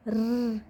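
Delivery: र said alone as a short r; phonic r sound. Shown as a demonstration. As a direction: sound out र briefly. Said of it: "इसकी sound होती है र".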